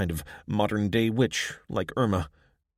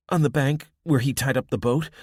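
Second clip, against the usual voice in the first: higher and louder, hurried answers; urgent girl; 6 semitones higher, 5 dB louder than usual. A narrator's reading. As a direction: answer quickly and anxiously. Abby answers Irma urgently.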